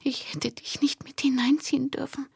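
soft, strangled whisper full of fear; Laura apologising to her brother in a choked whisper. A narrator's reading. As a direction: whisper as if your throat is closing. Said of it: "flüsterte Laura mit erstickter Stimme".

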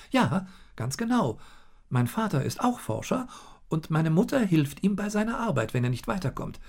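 höher, eifrige Kinderstimme; leicht gesprochener Junge; Jungenstimme. Acted. Einar, kleiner Junge antwortet. (eifriger Junge, höhere Stimme)

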